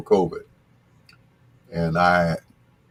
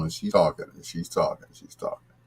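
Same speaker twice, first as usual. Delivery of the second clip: fading to a whisper; 3 semitones lower, 7 dB quieter, faster than usual. Retold or imitated. Lady talks on and on.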